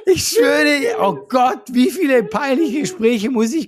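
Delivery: high, loud, embarrassed outburst; excited protest. Real, not acted. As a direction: swear it, half laughing. (aufgeregt und lauter beteuernd)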